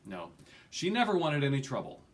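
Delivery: ordinary delivery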